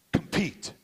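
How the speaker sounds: high, punchy chant back; chanted team cheer; team's shouted answer. Retold or imitated. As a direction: bark the reply like a huddle. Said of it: "The whole team responded".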